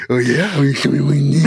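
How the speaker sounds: low mumbled "Oh, you show me my knee"; words barely pronounced. Retold or imitated. Husband to wife who knows it anyway, "Oh, you show me my knee" mumbled low. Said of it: "I don't have to pronounce any of the words".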